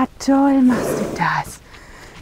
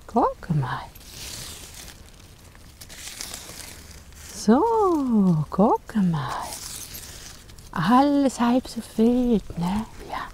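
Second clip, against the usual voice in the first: soothing a horse; 10 dB quieter, slower than usual. Real, not acted. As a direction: coax it gently, barely above a murmur.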